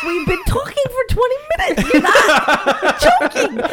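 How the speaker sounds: can't believe it, laughing through the words; laughing disbelief. Retold or imitated. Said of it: "I'm like".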